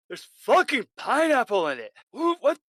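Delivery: high, loud furious rant; loud disgusted shouting; outraged yelling. Acted. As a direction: rage at the salad. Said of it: "looking at the potato salad and going".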